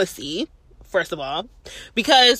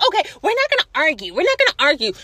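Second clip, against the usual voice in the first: higher, fast placating officer; mock cop placating; 7 semitones higher, faster than usual. The officer calming the narrator with quick placating words. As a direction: brush the fight off quickly. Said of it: "And she's like".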